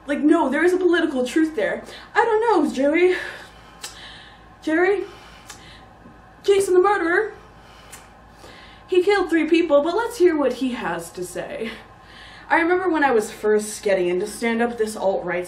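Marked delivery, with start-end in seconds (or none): 2.1-11.7 higher-pitched reporter, halting and uncertain; mock news reporter. Comedian mocks both-sides news reporting, voice raised high. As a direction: parody an over-fair reporter, pitched up, with long pauses.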